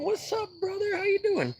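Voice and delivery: squeaky falsetto hello; high falsetto greeting. Pretend. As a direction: chirp it up in falsetto.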